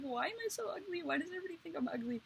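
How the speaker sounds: high, fast, whiny wail; mock whiny despair. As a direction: play up the childish self-pity. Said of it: "and I was like".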